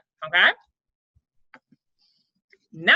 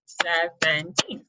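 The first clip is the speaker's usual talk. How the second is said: put-on "Seventeen" slowly split, SE, VEN, TEEN; broken into syllables; 4 semitones lower, much slower than usual. Shown as a demonstration. Teacher repeating the breaks for students, "Seventeen" in three slow beats. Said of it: "three different breaks ups in that word".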